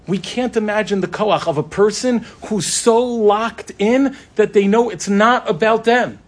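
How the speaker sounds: fervent sermon climax; impassioned preaching. Acted. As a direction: preach it, lift the room. Repeats phrase, building into preaching.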